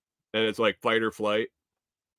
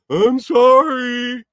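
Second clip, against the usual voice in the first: much higher, louder, slow whiny mock sorry; mocking kid's apology; 12 semitones higher, 9 dB louder, much slower than usual. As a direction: mimic the kid's fake apology. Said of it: "he grabs the collar and".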